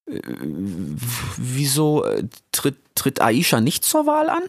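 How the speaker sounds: put-on high, halting young voice; timid Rix. (schüchterner Rix, hoch und stockend)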